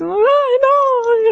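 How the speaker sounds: put-on very high, strained little-kid wail; upset child. Upset boy protests to his teacher, high wailing voice. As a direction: cry it like a distressed small boy. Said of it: "He's really upset".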